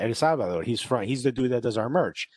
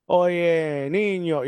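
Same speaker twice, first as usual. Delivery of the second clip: a touch of thick Cuban Spanish; mock Cuban; 4 semitones higher, much slower than usual. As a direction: call out like a proud Cuban. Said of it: "You're, like, mad Cuban".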